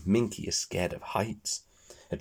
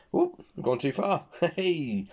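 laughing; 8 semitones higher than usual. Real, not acted. Narrator laughs at error.

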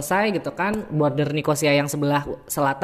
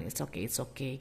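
much softer, gentle comfort; soothing; 3 semitones lower, 15 dB quieter than usual. Retold or imitated. Comforting a crying friend at the terminal, soft soothing.

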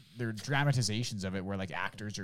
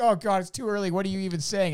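a touch of mock weariness, higher; tired traveller; 8 semitones higher, 4 dB louder than usual. Pitch meeting guy at security, lightly voiced tired traveller. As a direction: groan it out like it's too early. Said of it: "He's all fucking tired".

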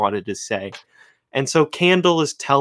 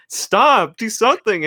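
higher, louder panicked command; mock scream; 7 semitones higher, 3 dB louder than usual. Candle screaming at the runaway punch bowl, mock yell. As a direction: cry out in imitation, without a full scream. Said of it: "the Candle's screaming at him".

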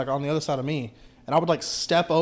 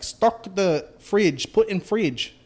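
slow, pitched-up broken English demand; mock shop owner accent; 4 semitones higher, much slower than usual. Delivery driver mimicking donut shop owner, slow mock accent. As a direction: drag out the order like the angry owner. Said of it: "she was like".

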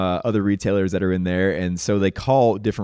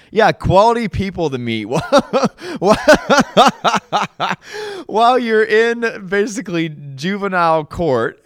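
higher and louder, words broken up by real laughter; laughing; 13 semitones higher, 8 dB louder than usual. Real, not acted. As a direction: laugh through it, mocking yourself. Laughing at meeting girls in court.

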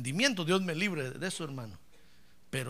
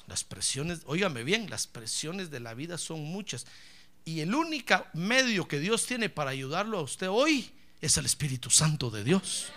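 ordinary talk

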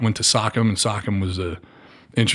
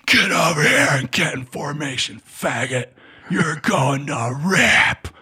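barking sergeant; 5 semitones higher, 4 dB louder, slower than usual. Retold or imitated.

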